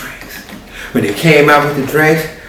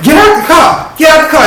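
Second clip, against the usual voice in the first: higher, loud repeated command; frantic order; 14 semitones higher, 5 dB louder than usual. Acted. Marquette ordering the girl out of the car, loud high command.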